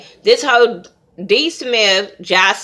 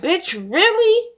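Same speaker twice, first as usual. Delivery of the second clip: much higher, slow disbelief; incredulous; 11 semitones higher, slower than usual. Retold or imitated. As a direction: stretch out your disbelief. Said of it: "And I'm like".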